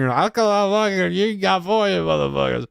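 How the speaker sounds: high, mock-drunken slur; words mashed together in a tipsy wail; slurred drunk. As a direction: imitate a drunk mom slurring her words. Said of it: "you can already hear her slurring".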